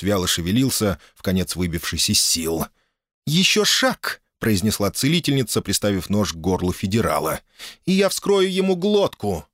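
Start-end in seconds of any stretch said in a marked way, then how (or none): 3.3-4.2 threatening. Acted. Healer threatens with knife. 7.9-9.5 threatening. Acted. Healer threatens to cut throat.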